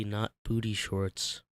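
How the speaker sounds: ordinary delivery, nothing marked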